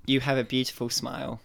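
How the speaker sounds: soft, low and earnest; sincere. Real, not acted. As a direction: say it like you mean it. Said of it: "I think he's being sincere now".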